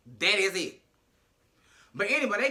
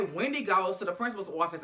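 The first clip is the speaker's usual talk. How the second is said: ordinary talk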